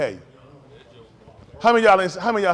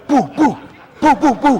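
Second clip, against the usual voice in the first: mock hit sounds; 5 semitones higher, 7 dB louder than usual. Imitating hits, sound effect.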